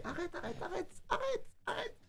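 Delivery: tiny, high, soft squeak; playful scolding. Real, not acted. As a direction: repeat it in a very high, quiet voice. Fending off baby grabbing headset.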